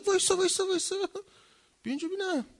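high, urgent stop-right-there call; calling someone back. Acted. Acted calling someone back to him. (با صدای زیر صدا زدن)

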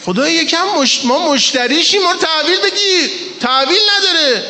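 high, impassioned preacher's cry; preaching at full force. Acted. Preacher voicing God's rebuke to the faithful, impassioned.

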